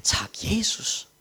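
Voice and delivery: slow unvoiced whisper; exhausted preacher thanks Jesus in a whisper. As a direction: breathe a slow, grateful whisper. Silent prayer of relief.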